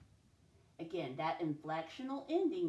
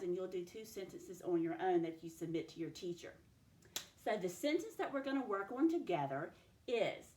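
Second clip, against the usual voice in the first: ordinary talk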